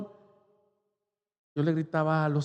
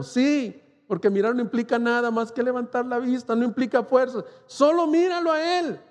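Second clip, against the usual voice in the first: much higher, ringing climax; impassioned preaching; 6 semitones higher than usual. Acted. Preacher pleading with the congregation to look to Christ, climactic. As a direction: lift the call to look at the cross.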